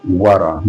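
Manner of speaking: WARA with the T tapped like an R; American flap T. Shown as a demonstration. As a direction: tap the middle of WARA like an R. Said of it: "in U S, we call it".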